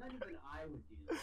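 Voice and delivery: laughing while teasing. Real, not acted. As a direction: say it through laughter. Laughing at friend's mistake.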